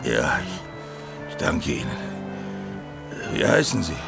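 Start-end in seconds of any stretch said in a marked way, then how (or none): 0.0-1.9 weak whisper. Acted. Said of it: "bewusstlosen Mann ... Schlag aufs linke Auge".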